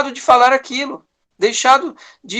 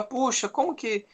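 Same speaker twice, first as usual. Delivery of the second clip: hushed, muttered complaint against God; soft mock lament of someone feeling restricted; quiet grumble; 3 semitones higher, 8 dB quieter than usual. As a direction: mutter the grievance under your breath. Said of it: "Nós podemos olhar e falar".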